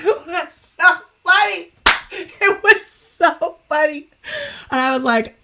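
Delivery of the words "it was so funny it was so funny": high, loud, laughing exclamation; cracking up. Real, not acted. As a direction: barely get it out through laughter. Cracking up at her own story.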